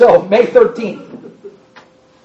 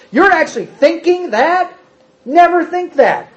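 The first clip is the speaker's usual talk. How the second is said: incredulous outrage; 7 semitones higher than usual. Acted. Voicing Paul's repulsion.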